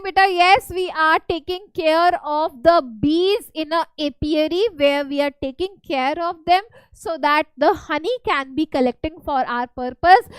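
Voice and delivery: ordinary talk, nothing marked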